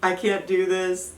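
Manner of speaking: retelling a tearful give-up in labor; half-sobbing retold protest; crying. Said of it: "I start crying because I'm like".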